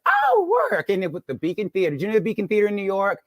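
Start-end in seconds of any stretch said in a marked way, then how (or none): 0.1-0.9 high, playful mock-impressed "oh"; coy high-pitched quote of herself; retold impressed reply. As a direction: re-enact being impressed, swooping way up. Acting her impressed reply.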